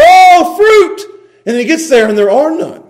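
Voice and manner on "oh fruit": loud, high exaggerated cry; acted hungry exclamation, pitched way up; mock frustrated outburst. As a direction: cry out in hammed-up exasperation. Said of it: "he got so frustrated".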